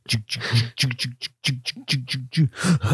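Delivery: quiet rhythmic step-machine chugging; imitated machine noise. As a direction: chug softly like a step machine. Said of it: "you just hear".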